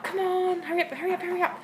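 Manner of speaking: high, urgent "hurry up" to the dog; high hurrying call. Shown as a demonstration. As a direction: chirp it up high to rush her along. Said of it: "sometimes if I'm like".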